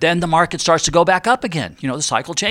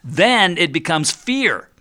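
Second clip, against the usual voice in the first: usual speaking voice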